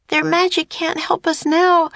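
high-pitched alarmed cry. A narrator's reading. Annie in danger from the serpent, crying out. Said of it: "cried Annie".

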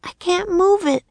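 put-on high little-girl voice, croaked; a girl turned raven, croaking. Annie to Jack in the nursery, high croaking girl. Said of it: "she croaked".

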